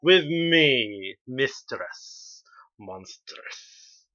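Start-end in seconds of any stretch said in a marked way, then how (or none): 1.3-4.0 low, slow, quiet sinister title; dramatic slow villain intro. Acted. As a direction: purr your villain title slowly. Introducing own stage name.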